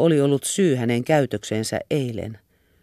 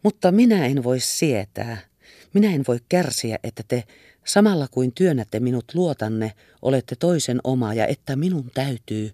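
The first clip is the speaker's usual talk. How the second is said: usual speaking voice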